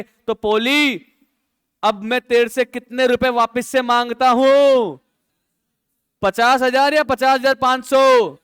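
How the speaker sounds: slow, insistent shouted question; demanding confrontation. Acted. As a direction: press Poli hard for the money. Goli demands money from Poli.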